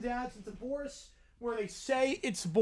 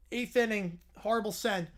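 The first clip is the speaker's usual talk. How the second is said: ordinary talk, nothing marked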